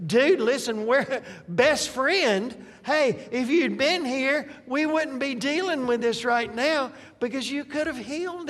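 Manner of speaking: exaggerated indignant reproach; mock blaming. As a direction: play the aggrieved best friend laying blame. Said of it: "She's blaming him".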